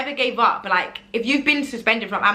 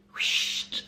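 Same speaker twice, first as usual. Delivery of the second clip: whoosh sound; 8 dB quieter than usual. Shown as a demonstration. Fast-forward sound in the story.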